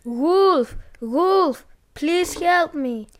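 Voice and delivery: high desperate cry, slowing on please help me. A narrator's reading. Boy alone with the wolf, crying out to the villagers. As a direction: beg the village, voice pleading. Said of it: "he continued crying".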